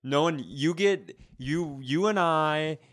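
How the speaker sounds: ordinary talk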